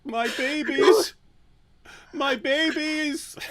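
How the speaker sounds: hammed-up grief for lost babies; mock wailing. Mock lament over deleted videos.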